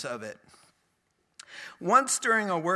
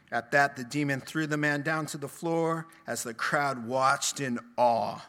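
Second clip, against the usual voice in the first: ordinary talk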